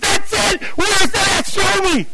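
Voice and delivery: high-pitched shouted eagerness; excited outburst. Retold or imitated. Seeker hears the verse read, excited shouting. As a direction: burst in high, thrilled at finding it.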